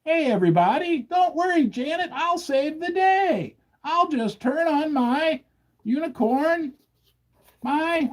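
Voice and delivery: a hint of a cheery official promising rescue; mock Fed chairman. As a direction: play the smug savior of the day. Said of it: "The original man in the box".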